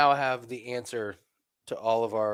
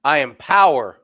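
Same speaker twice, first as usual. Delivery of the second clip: loud, firm, stress on power; loud firm declaration; 2 semitones higher, 9 dB louder than usual. Retold or imitated. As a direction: plant the word power with weight. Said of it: "You go".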